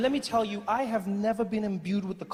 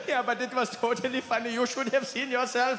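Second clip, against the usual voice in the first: put-on high, gleeful German-accented teasing; hammed-up amused German friend; laughing German friend; 6 semitones higher than usual. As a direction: tease through mock laughter with a German accent. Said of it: "He's like".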